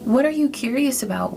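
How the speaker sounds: usual speaking voice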